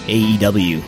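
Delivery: ordinary talk, nothing marked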